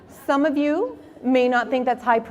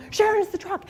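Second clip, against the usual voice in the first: high eager call to his wife; high thrilled announcement; excited call; 11 semitones higher than usual. Retold or imitated. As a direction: call out like a kid on Christmas. Dad calls out excited.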